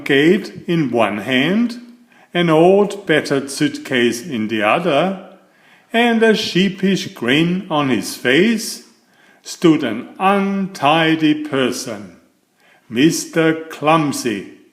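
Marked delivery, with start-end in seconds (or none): none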